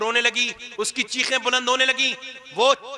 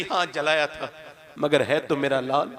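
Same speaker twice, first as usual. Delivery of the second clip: tender mother; 9 semitones lower than usual. Acted. Mother defending her son before God, tender. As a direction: plead gently, full of love. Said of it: "अर्ज करेगी".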